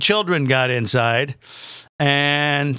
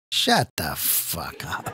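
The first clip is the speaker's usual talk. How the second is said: a short, slow, lower whisper under the breath; whispered aside; 13 semitones lower, 7 dB quieter, slower than usual. Real, not acted. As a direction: hiss it as a disgusted aside. Muttered aside at protesters.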